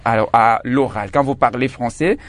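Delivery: ordinary talk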